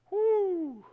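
high sustained "woo"; preacher's whoop. Real, not acted. Preacher capping their testimony, high whoop. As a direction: let out a high whoop after the climax.

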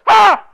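sudden piercing scream; high, explosive "Ah!"; top-of-the-voice demoniac cry. Retold or imitated. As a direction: startle them with a short, full-force shriek. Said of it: "cried out at the top of his voice".

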